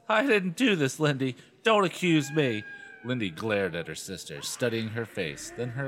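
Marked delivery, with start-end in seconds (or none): 0.1-2.6 high, upset denial; angry, shrill. A narrator's reading. Chris denies wrecking the dummies, shrill and angry. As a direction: protest innocence in a raised, shrill pitch. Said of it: "replied angrily in a shrill, trembling voice".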